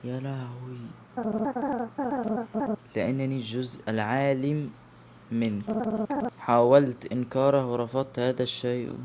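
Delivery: stunned whisper. Real, not acted. Stunned, sad realisation.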